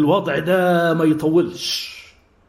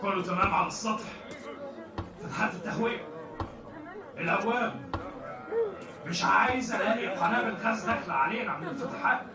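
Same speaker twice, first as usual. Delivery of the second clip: softer commanding officer checking every vent; quiet orders; 3 semitones higher, 13 dB quieter than usual. Acted. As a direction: murmur commands close to the men. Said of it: "يصدر التعليمات لرجاله".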